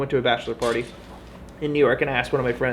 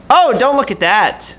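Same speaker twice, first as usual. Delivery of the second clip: startled cry; 7 semitones higher, 9 dB louder than usual. Real, not acted. Hiding the screen from view.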